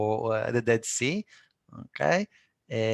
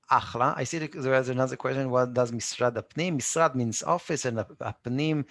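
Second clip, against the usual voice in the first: ordinary talk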